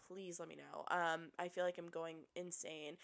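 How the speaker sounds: ordinary talk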